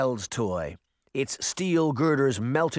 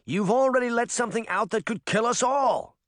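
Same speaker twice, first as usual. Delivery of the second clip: higher urgent shout; 10 semitones higher than usual. A narrator's reading. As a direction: shout at him to stop. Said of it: "Uncle Jake shouted".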